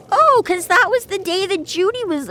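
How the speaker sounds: the penny drops, squeaked out high; belated realization. Retold or imitated. Employee remembering the excuse too late, high put-on voice.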